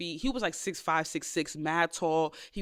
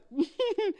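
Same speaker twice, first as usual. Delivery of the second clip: high laugh; 12 semitones higher than usual. Real, not acted. Laughing at being accused.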